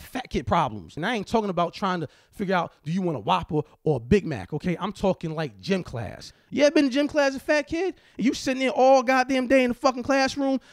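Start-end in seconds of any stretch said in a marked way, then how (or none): none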